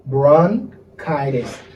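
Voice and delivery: "bronchitis" very slow, "bron-KY-dis"; repeated correct pronunciation. Shown as a demonstration. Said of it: "One more time".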